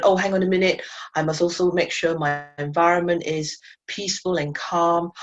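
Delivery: normal talking voice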